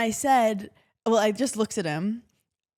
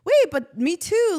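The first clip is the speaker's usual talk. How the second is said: high, louder and slow mock excitement; eager agreement; 9 semitones higher, 6 dB louder, slower than usual. Friend recognising herself in you, high and slow. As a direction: stretch it out as if amazed to share it. Friends relating, acted quote.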